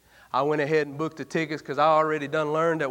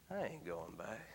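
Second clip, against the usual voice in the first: quiet refusal; 9 semitones lower, 20 dB quieter than usual. Acted. Stubborn refusal after fever left.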